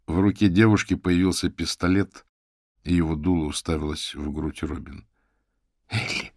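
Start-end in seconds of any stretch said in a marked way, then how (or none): 5.9-6.3 gasping. A narrator's reading. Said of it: "ахнула та".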